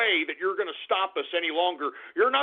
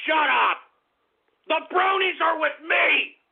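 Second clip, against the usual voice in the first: raised, higher-pitched shout; angry shout; 6 semitones higher than usual. Acted. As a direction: shout the chat down. Host enraged by chat shout-outs.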